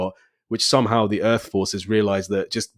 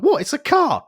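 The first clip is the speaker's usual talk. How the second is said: mock surprise. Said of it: "He's like".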